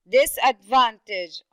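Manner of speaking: slow, loud disadvantage with DIS and VAN punched; stress on first and third syllables. Shown as a demonstration. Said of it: "it would be the first".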